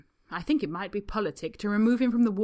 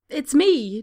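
much higher and louder outburst; blurted cry; 7 semitones higher, 14 dB louder than usual. A narrator's reading. Draco crying out to hide the clicking beetle. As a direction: blurt it out in panic. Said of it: "Draco cries".

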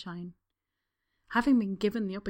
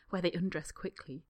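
ordinary delivery

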